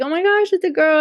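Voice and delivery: high-pitched imitated surprise; retold surprise. Wife learning it's a girl, retold surprise. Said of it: "So then she was surprised".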